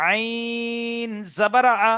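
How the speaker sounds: "আইন" drawn out very long and level; model elongated spelling. Shown as a demonstration. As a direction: hold "আইন" on one steady tone, then finish the spelling. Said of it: "একটা উদাহরণ দিয়ে বুঝিয়ে দিচ্ছি". ("আইন"-এ তিন আলিফ টেনে বানান)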